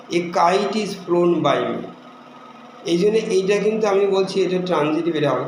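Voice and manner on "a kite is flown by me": each word spaced out, very slowly; slow dictation. Shown as a demonstration. Teacher dictating an example sentence to students, slow and deliberate. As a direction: read it slowly for the class to copy. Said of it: "কীরকম?".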